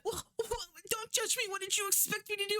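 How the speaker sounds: high, fast, whiny defensive squeak; mock defensive mom. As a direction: play her as a flustered, guilty mother. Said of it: "she's like".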